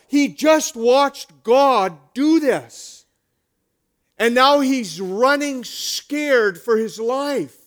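loud, high, driving sermon delivery; fiery preaching. Acted. Pastor rousing the congregation about Elijah's fear, loud fiery preaching. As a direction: preach it hard and drive the point home.

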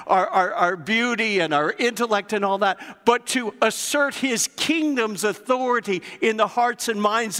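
preacher building fire before the congregation; full-force preaching. Acted. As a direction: drive it home from the pulpit.

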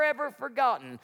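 ordinary talk, nothing marked

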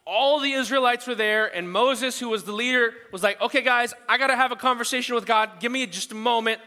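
loud, high preaching voice; full-force preaching. Acted. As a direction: preach it out to the whole hall. Preaching the Moses story aloud.

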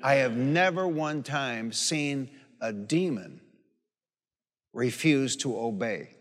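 slow, weighty testimony with long pauses; solemn preaching. Real, not acted. Preacher declaring to the congregation that Jesus' name never fails, slow and weighty. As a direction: let each phrase land like a vow.

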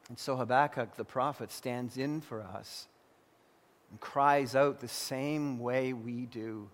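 ordinary talk, nothing marked

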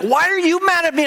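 high-pitched indignant protest. Retold or imitated. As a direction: act out a wronged husband arguing back. Retold quarrel with angry wife.

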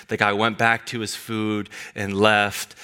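usual speaking voice

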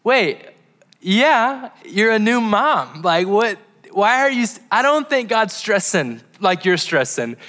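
high, astonished disbelief, slowing down; incredulous. Retold or imitated. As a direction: react like she can't believe her friend's worry. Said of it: "And I was like".

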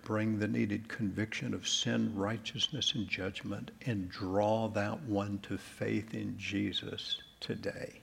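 hushed, measured pastoral prayer; closing prayer. Real, not acted. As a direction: pray it gently and reverently, leaving space between phrases.

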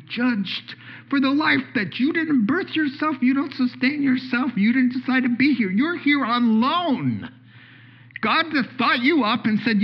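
high, worked-up preaching; excited, high-pitched pulpit delivery; real excitement. Real, not acted. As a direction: preach it with mounting passion, pitch up. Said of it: "All right, I'm getting excited".

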